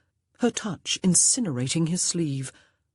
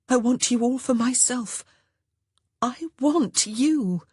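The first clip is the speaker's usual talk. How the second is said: higher, breathless whispers swelling, slowing on I want you; impassioned young woman; 8 semitones higher than usual. Acted. Honor confesses her desire to George, impassioned. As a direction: pour it all out.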